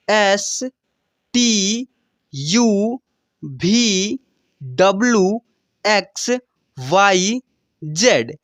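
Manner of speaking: letters recited one by one with pauses; teacher reciting the alphabet for students; alphabet recitation. Shown as a demonstration. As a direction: call out each letter like a teacher drilling a class. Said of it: "जैसे उच्चारण कराया हूँ".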